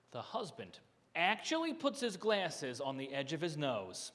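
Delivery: ordinary delivery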